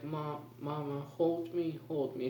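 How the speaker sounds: high, soft pleading for her mom; pleading cry. Retold or imitated. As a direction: softly beg mama to hold you, a touch tearful. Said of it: "She's, like, crying".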